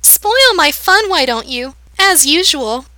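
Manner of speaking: put-on high, loud, slow whiny girl; childishly petulant. A narrator's reading. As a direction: sulk like a spoilt child. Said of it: "childishly petulant".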